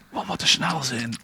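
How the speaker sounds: whispered. A narrator's reading. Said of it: "fluisterde er".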